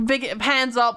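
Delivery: ordinary talk, nothing marked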